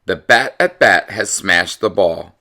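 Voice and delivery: ordinary delivery, nothing marked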